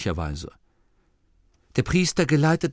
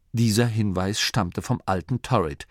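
ordinary delivery, nothing marked

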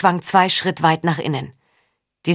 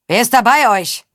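louder, higher challenge of a guard; barsches Rufen; 5 semitones higher, 5 dB louder than usual. A narrator's reading. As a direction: bark the question down from the wall. Torwächter bellt von oben herab. (barsch von oben herabrufen)

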